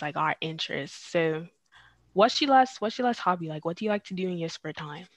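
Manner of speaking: ordinary talk, nothing marked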